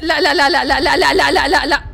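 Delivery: loud rapid no, no, no; frantic refusal; panicked no. Real, not acted. Baby attacking again. (رفض مذعور)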